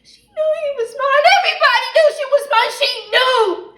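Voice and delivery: high, loud, rushing wail; anguished accusation. Acted. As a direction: cry it out, wounded and furious. Accuses sister of betrayal.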